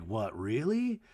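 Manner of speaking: low, soft doubting question; quietly skeptical impression; imitated skepticism. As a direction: mutter it low, unconvinced. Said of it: "he's skeptical".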